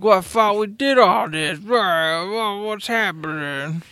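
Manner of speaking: put-on high, confused drunken whine. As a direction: slur it out, lost and baffled. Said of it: "we'd be like".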